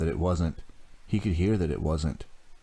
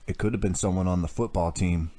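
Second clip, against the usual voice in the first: ordinary delivery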